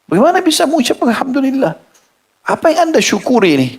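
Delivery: can't believe what he's hearing; astonished disbelief. Acted. Stranger asks the grieving father, astonished.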